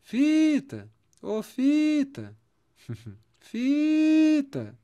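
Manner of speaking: calling "Fita!" loud and high, leaning on "Fi". Shown as a demonstration. Teacher calls the word out to find its stressed syllable, loud and high. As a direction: shout "Fita!" as if calling someone from far away, stressing "Fi". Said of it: "é só você chamar essa palavra".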